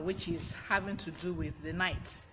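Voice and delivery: ordinary talk, nothing marked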